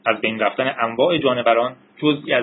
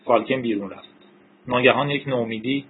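usual speaking voice

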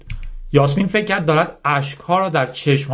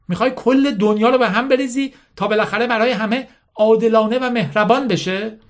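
higher-pitched challenging questions, lightly acted; scornful General Mala; 8 semitones higher than usual. Mala taunting little Yasmin about her mission, lightly voiced scorn. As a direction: press her with mocking questions.

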